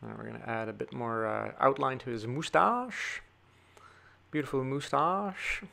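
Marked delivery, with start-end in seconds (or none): 4.3-5.6 bad UK accent. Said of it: "Whatever that accent is, horrible".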